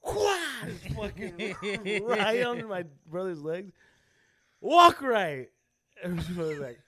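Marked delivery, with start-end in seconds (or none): 0.0-0.6 a sharp, high whip-crack yelp, loud; whip crack noise. Retold or imitated. Telling friends how dad whipped brother, mock whip-crack yell. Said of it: "And then he'd just go". 4.6-5.5 loud, high retold bark of an order; dad yelling. Dad scolding brother while whipping him, loud high yell. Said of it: "And then he'd just go".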